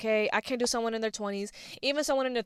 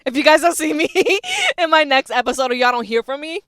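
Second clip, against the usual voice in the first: laughing mock drama; 5 semitones higher, 9 dB louder than usual. Real, not acted.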